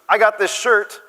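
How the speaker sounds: put-on high-pitched girl showing off; imitated daughter. Daughter calling from back seat to barista, imitated girlish voice. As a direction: pipe up like an eager daughter. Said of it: "my daughter rolls the window down".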